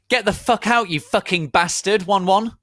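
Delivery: very high, loud mock shriek; hammed-up yell. Ebony yelling at Voldemort, hammed-up shriek. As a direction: scream it like a teen tantrum. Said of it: "I yielded".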